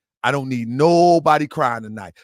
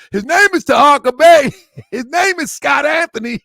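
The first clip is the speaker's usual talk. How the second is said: high, loud ring-announcer call; 9 semitones higher, 6 dB louder than usual. Retold or imitated. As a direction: call the name out high, like an introduction. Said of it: "You know how they call me out".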